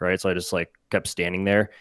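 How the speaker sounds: ordinary talk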